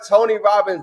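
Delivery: ordinary delivery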